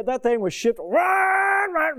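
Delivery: high shouted engine roar; imitating a roaring engine. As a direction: shout it like a car flooring it. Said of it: "the sound of that L T D Ford".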